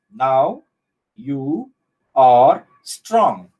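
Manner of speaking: each word set apart by a pause. Shown as a demonstration. Teacher showing one syllable in each word, separated word by word. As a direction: separate the monosyllables one at a time.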